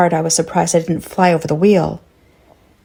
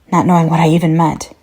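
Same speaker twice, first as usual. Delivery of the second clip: plain reading voice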